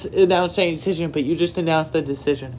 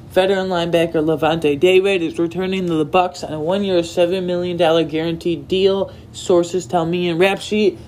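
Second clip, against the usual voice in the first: usual speaking voice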